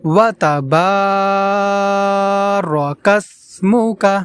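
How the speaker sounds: long drawn-out ওয়া তাবার কাসমুকা; slow drawn recitation. Shown as a demonstration. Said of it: "এইভাবে পড়ব".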